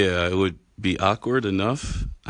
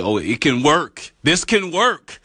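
rising excitement; 8 semitones higher, slower than usual. Pretend. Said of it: "Hopefully I scared you right now".

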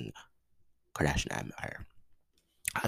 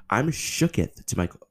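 shocked outburst; 6 semitones higher than usual. Real, not acted. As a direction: blurt it out in shock.

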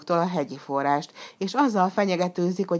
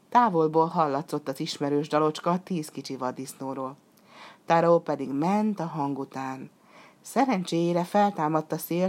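plain reading voice

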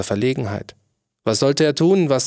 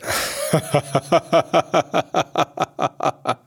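king's hearty laugh; 3 semitones higher than usual. A narrator's reading. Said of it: "lachten seine sieben Minister mit".

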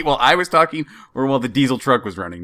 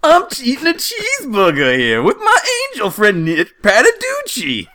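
weird Jersey guy. Pretend. Bragging about a cheeseburger with a friend, hammed-up Jersey accent. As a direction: ham up a Jersey guy, pitched way up. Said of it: "for my weird Jersey guy".

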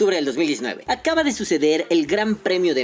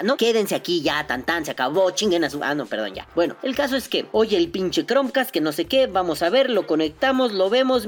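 ordinary talk

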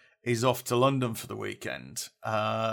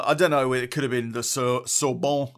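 usual speaking voice

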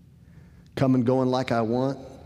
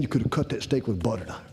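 ordinary talk

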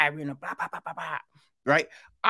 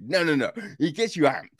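ordinary talk

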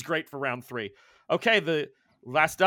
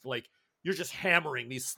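ordinary talk, nothing marked

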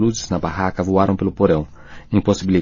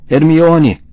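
loud high cry of her name; desperate scream; 8 semitones higher, 6 dB louder than usual. A narrator's reading. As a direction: shout for her in anguish. Rony screams as she is tortured. (grito desesperado de Rony)

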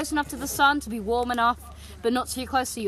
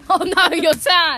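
mocking echo; 3 semitones higher, 6 dB louder, slower than usual. Mocking friend's cry.

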